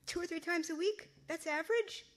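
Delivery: can't believe the number; surprised. Acted. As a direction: daughter stunned by her mother's answer, incredulous. Daughter shocked by the answer.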